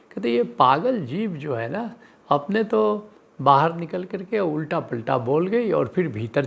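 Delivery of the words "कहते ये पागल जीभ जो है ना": ordinary talk, nothing marked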